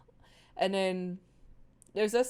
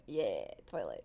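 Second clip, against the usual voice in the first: cool name; 14 semitones lower, 7 dB quieter than usual. Real, not acted.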